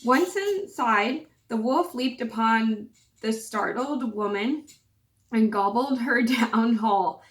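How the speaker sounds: usual speaking voice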